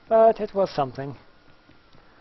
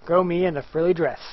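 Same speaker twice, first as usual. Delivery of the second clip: ordinary delivery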